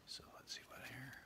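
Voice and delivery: soft unvoiced aside; hushed aside to the page; whispered aside. Real, not acted. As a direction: whisper to yourself while looking. Reader aside while turning page.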